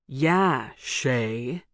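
sneering, drawn-out name; mock her from behind her brother. A narrator's reading. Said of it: "Jason mocked".

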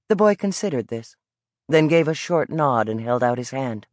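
usual speaking voice

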